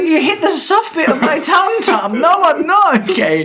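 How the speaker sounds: put-on high, loud, babyish whine, laughing; whiny hurt man. Retelling the film, the beaten villain whining about his tummy. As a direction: whimper like a wounded child. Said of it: "And he's like".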